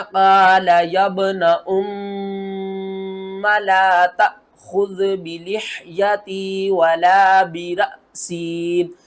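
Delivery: very slow tajweed chant; Quran recitation demo. Shown as a demonstration. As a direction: recite with long held nasal and vowels. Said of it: "इसको किस तरह पढ़ना". (गुन्ना और मद के साथ तिलावत)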